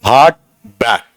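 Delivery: Heart break with equal weight; both words stressed. Shown as a demonstration. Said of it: "जैसे एक उच्चारण आया था".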